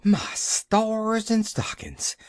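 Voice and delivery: high, slow, gasped exclamation; gasping shock. Acted. Nancy gasps hearing Pollyanna climbed down the tree. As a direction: gasp it out in shock. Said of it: "gasped Nancy".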